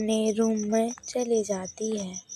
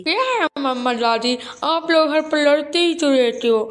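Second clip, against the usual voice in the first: put-on higher, louder little boy begging; whiny child voice; 3 semitones higher, 7 dB louder, faster than usual. Grandson begging mother and grandmother to take him to the fair, whiny. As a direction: plead like a small boy wanting the fair.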